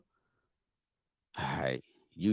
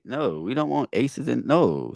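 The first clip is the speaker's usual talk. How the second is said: ordinary delivery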